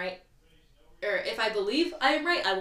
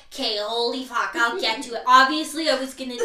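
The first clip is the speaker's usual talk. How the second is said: imitating a friend's annoyed reply, louder; mock irritated snap; 3 semitones higher, 7 dB louder than usual. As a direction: play the annoyed roommate, hammed-up. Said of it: "And you're like".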